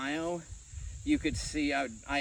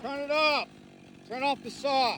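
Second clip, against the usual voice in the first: shouting over table saw; 11 semitones higher than usual. Real, not acted. Calling out over running saw.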